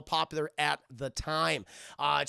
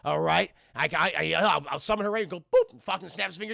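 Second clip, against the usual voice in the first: ordinary talk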